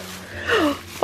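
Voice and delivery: soft, high intake of breath; awed little gasp; amazed gasp. Real, not acted. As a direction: gasp at a lovely surprise. Sees the beautiful packaging.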